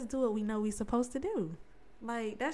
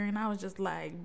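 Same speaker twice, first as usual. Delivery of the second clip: ordinary talk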